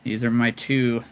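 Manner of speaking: usual speaking voice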